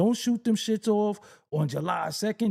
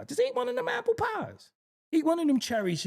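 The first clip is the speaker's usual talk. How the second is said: high squeaky dare; 9 semitones higher than usual. Real, not acted. Daring the audience, squeaky.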